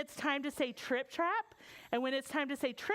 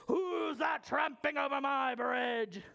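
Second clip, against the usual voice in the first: growly, shouted troll challenge; mean troll. Acted. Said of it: "out pops the mean troll and he says".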